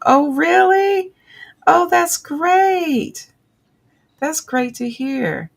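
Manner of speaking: high, slow, gushing "that's great"; delighted. Real, not acted. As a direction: beam with joy for a friend. Happy for the viewer's move.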